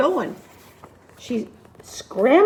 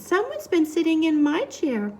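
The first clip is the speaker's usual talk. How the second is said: Mama Bear; 2 semitones higher than usual. A narrator's reading. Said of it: "Mama Bear looked at her middle-sized chair and said".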